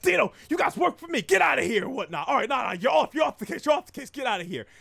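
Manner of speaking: loud, high, mad outburst; Ash, irritated. Retold or imitated. Gang leader kicks his boys off the case, shouting mad. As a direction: throw them out like an angry boss. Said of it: "really irritated and mad right now".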